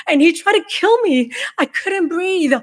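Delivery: high, strained disbelief; anguished reliving. Retold or imitated. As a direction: still shaken it happened. Reliving attempted choking.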